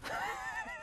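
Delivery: high unvoiced panting gasp; breathless Warrior huff. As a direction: pant like a crazed wrestler. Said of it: "I will do one".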